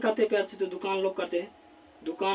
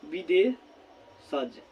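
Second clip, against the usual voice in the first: विदेशज stretched very slowly, syllable by syllable; drawn-out spelling word; 3 semitones lower, much slower than usual. Shown as a demonstration. Teacher to students, stretching विदेशज slowly for dictation. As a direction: draw out विदेशज slowly for the class to write. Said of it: "तो प्यार साथियों क्या हो जाएगा?".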